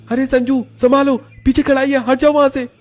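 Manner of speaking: high, loud shout of alarm; shouted, high-pitched alert; urgent warning. Acted. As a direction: yell a sudden danger warning. Warning of hot oil behind.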